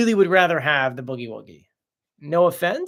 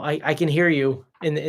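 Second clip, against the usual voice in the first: ordinary talk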